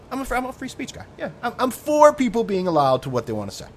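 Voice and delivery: high, smug swagger; hammed-up self-righteous brag; smug boast. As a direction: preen like a self-declared champion of freedom. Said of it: "big dick swinging".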